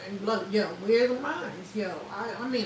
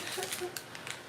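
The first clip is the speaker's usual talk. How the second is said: breathy laugh; 7 semitones higher, 12 dB quieter than usual. Real, not acted.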